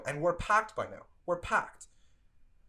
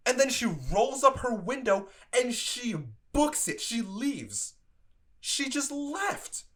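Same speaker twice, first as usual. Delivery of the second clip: high-pitched, disbelieving outburst; incredulous; 7 semitones higher than usual. Real, not acted. Worker recounting how she just left, incredulous. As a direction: stretch the words in outraged disbelief.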